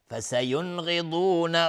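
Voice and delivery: slow chanted "فسینغضون" with n sounded plainly; clear n before gh. Shown as a demonstration. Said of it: "نون ساکن برش غین اظهار حلقی". (اظهار حلقی نون در «فسینغضون»)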